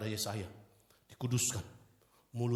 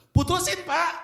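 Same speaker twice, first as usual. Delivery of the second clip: high, loud startled question; shocked he asks this; high startled echo; surprised; 10 dB louder, slower than usual. Retold or imitated. Surprised reply in dialogue.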